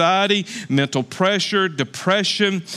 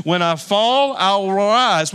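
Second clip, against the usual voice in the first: saying it together; 2 semitones higher, much slower than usual. Shown as a demonstration. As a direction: lead the congregation in saying it aloud. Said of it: "Everybody say that together with me".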